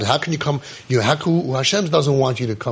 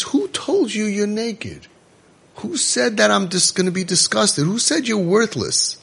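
tender God; 6 semitones higher than usual. Acted. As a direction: coax him like a hurt child. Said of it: "So Hashem says".

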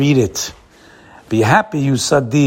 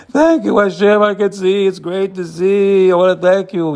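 higher, stretched, grateful little prayer; heartfelt thanks; 5 semitones higher, slower than usual. Pretend. Voicing a listener thanking Hashem for sight, higher and grateful. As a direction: pray it up out of sheer gratitude. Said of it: "and say".

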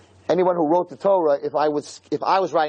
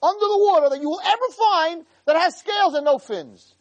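bold claim; 13 semitones higher than usual. Retold or imitated. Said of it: "make a statement".

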